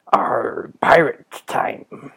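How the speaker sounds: raspy, slow pirate growl. Acted. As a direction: croak it like a pirate with a worn-out throat. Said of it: "I don't know what happened with the pirate thing".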